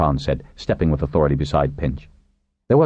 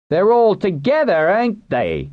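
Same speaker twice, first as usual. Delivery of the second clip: high, raised crowd voice; rough heckler; slower than usual. A narrator's reading. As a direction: throw the question out from the crowd. Fist waver challenges from crowd.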